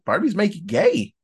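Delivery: mock an alpha male, pitch swooping up. Joking with co-host about Barbie videos, mock alpha male. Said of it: "alpha male impression".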